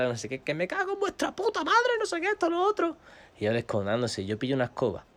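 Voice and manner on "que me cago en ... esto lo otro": high-pitched ranting, retold; hurling insults. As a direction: rant down from the terrace in a high voice. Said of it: "yo empezando a insultar".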